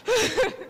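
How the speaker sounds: high-pitched laugh; giggle of relief. Real, not acted. Young winner after her most stressful race, high relieved giggle. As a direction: let out a short, high giggle.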